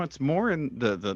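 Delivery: ordinary delivery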